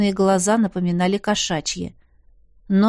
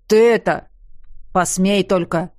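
vague threat. Acted. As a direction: warn him like a grumbling guard. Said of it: "туманно пообещал старший".

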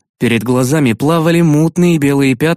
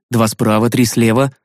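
usual speaking voice

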